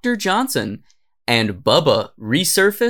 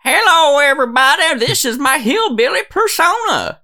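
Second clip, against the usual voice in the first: high, loud mock hillbilly greeting; 13 semitones higher, 4 dB louder than usual. Friend mocks the video's host in a high, loud hillbilly voice. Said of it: "him driving in his car going".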